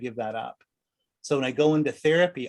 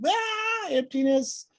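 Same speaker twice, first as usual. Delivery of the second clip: high, alarmed lizard-brain voice; shrill panicked little voice; panicked ego; 11 semitones higher, 3 dB louder than usual. Retold or imitated. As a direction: squeal like a frightened ego. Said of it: "The lizard brain of your ego is like".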